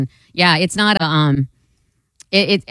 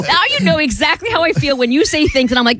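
high, exaggerated host mimicry; being the host; 6 semitones higher than usual. Co-host gets back at host, high mocking imitation. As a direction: turn the host's voice back on him. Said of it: "I'm just being you".

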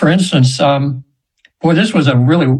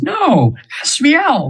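angel correcting; 11 semitones higher, much slower than usual. Retold or imitated. Angel corrects her name's pronunciation.

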